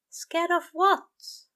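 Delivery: slow, high, anxious question; worried angel. A narrator's reading. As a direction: protective concern for the demon.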